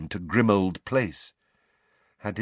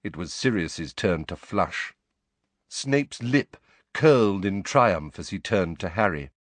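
plain reading voice

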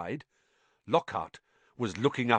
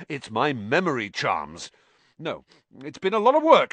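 impatient; 6 semitones higher, 3 dB louder than usual. Acted. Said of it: "shaking his head impatiently".

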